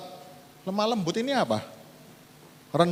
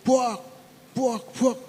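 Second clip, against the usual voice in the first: high, punchy crash sounds; imitated table crashes; 8 semitones higher, much slower than usual. Preacher imitating Jesus overturning temple tables with crash sounds. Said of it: "meja dibalik".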